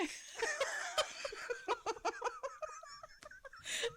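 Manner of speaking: high-pitched helpless laugh; cry-laugh at the top of the voice; high wheezing laugh. Real, not acted. Hysterical laughter after story.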